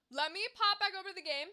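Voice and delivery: normal talking voice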